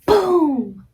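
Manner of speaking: short high shout of boom; playful boom shout. Shown as a demonstration. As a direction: shout boom as you squeeze.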